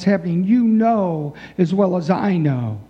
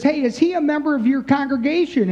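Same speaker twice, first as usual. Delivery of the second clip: higher-pitched, pushy caller voice; IRS caller; 6 semitones higher than usual. Retold or imitated. Preacher's joke, IRS agent grilling priest, higher pushy voice. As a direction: press the priest like a nosy tax agent.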